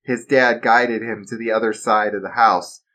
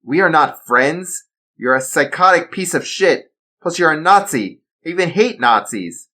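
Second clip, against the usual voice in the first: raised, louder, contemptuous; fearless insult; 7 semitones higher, 5 dB louder than usual. Acted. Assassin insulting the Nazi villain, fearless. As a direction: spit the insults without fear. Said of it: "Darren said with no fear".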